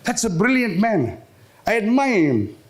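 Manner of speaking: ordinary talk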